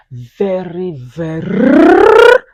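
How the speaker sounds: very with a long rolled trilled R, rising loud; very, very with a strong rolling R. Shown as a demonstration. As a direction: roll the R hard in very, very. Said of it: "emphasis on the R".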